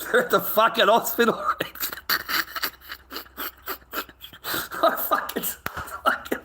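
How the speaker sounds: real laughter breaking in as he brags; laughing. Real, not acted. Laughing while telling a friend the bloke ended up in hospital.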